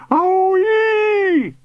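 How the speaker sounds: very high, loud whoop; high-pitched shout. A narrator's reading. Uncle somersaulting through the air, shouting. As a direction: shout it out as you soar. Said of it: "Uncle Arvy shouted".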